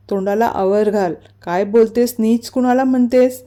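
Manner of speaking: usual speaking voice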